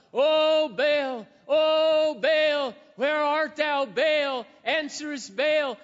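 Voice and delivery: shouting to Baal. Acted. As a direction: cry out in a frenzy. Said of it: "they're shouting out loud".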